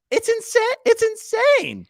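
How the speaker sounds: high, slow squeal of disbelief; outraged squeal. Real, not acted. Squealing at the Kill Tony ticket price. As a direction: squeak it out in shock.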